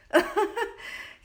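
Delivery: high breathy burst of laughter. Real, not acted. Showing holey curtains to viewers, a high laugh.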